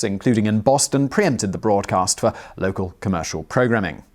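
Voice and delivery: normal talking voice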